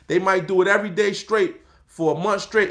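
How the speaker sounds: ordinary talk, nothing marked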